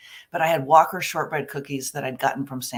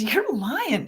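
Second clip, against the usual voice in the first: higher, teasing accusation; mock accusing coworker; 7 semitones higher than usual. Coworker catching her lying about cookies, high teasing accusation. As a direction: call her out playfully.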